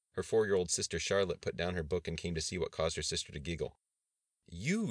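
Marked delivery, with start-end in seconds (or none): 4.5-4.9 high-pitched, swooping command; single out the culprit sharply; demanding. A narrator's reading. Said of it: "Darlene demanded".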